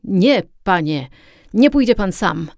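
loud, emotional refusal from the captain; moved exclamation. A narrator's reading. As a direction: call it out firmly, deeply moved. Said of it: "zawołał wzruszony kapitan".

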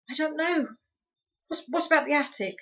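put-on anxious boy thinking aloud; worried boy voice.

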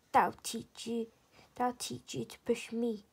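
ordinary delivery, nothing marked

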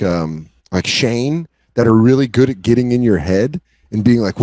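ordinary talk

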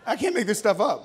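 real amused laugh as he speaks. Real, not acted. Laughs at his own story.

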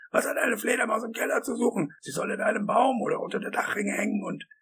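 high, agitated rant; tobend. A narrator's reading. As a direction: rave on indignantly, pitch way up. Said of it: "tobte Wisconski". (aufgebracht mit hoher Stimme tobend)

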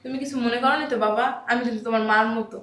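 placating. Acted. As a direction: soothe the offended guest gently. Mother placating the insulted boss. (নরম গলায় মায়ের মতো সান্ত্বনা)

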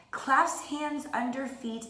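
normal talking voice

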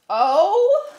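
high, loud drawn-out oh; shocked oh. Real, not acted. Finding a latex romper in the box, a loud shocked oh. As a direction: gasp it out in disbelief.

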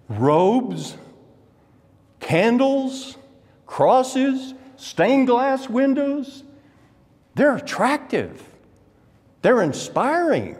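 high, ringing pulpit delivery; impassioned preaching. Acted. Preaches at full pitch.

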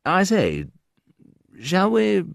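a hint of a prim Englishman, shooting high at the end; prim English Brit, rising high. Shy Englishman to a girl, pitch rising high. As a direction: ask it like a bashful gent, pitch climbing high.

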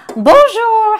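high, loud singing-out call; exuberant French greeting. Pretend. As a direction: belt out a playful French hello. Playfully calling out in French.

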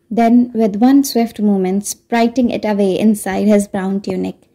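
ordinary delivery

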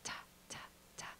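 soft unvoiced ta ta in a steady beat; rocking whispered ta's; whispered rhythm. Shown as a demonstration. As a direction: whisper the ta's like patting a baby. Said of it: "put those together in our voices".

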